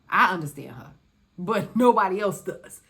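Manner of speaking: loud laughing brag. Real, not acted. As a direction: crack up while bragging. Laughing boast.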